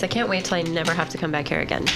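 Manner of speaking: usual speaking voice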